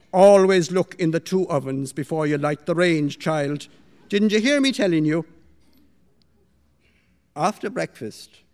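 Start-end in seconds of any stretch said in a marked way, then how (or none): 0.1-5.3 scolding mistress. Acted. Mistress scolding young maid about the ovens, loud rebuke. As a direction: rebuke the maid sharply and loudly.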